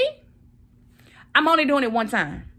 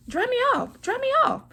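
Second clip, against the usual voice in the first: whiny high plea. Retold or imitated. To her husband drying her off, a squeaky high plea.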